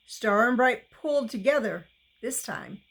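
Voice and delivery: plain reading voice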